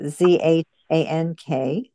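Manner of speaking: usual speaking voice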